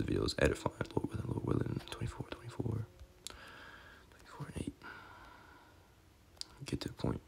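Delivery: whispered self-talk while searching for verses; muttered aside. Real, not acted. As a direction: mumble to yourself under your breath. Muttering to himself while searching scripture.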